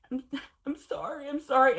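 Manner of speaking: high, teary, swelling into a loud sorry; tearful apology. Retold or imitated. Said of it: "I'm like, I'm crying".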